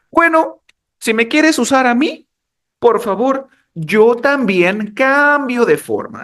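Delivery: high voice, slowing on the last words; personified verb's demand. Acted. Verb setting its conditions for users, playful demanding voice. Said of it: "Dijo así".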